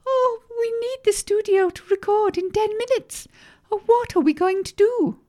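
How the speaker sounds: very high-pitched fretful secretary; high, whiny cartoon despair; distressed wail. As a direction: wail it in helpless panic. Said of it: "wailed the secretary".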